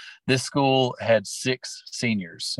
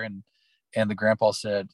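ordinary delivery

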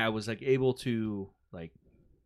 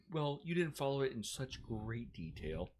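slow prissy teacher impression; mocking teacher; 9 dB quieter than usual. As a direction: drag it out like a stickler. Mocks the stickler teacher.

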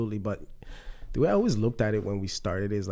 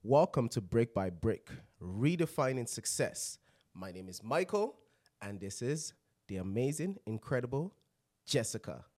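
announcer intro; 6 dB quieter than usual. Acted. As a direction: show host announcing names. Host announces show and co-host.

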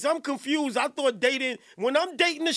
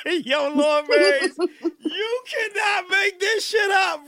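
slow, laughing shout, louder; 3 semitones higher, 3 dB louder, slower than usual. Real, not acted. Host calls out to a viewer, laughing and shouting. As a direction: drag it out through laughter.

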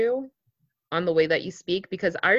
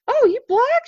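high, loud surprised exclamation; a client blurts out her shock; 6 dB louder than usual. Retold or imitated. Client meeting him blurts out surprise.

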